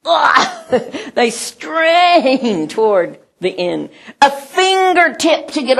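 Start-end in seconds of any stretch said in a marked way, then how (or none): 0.1-0.6 straining grunt. Shown as a demonstration. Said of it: "you know, like".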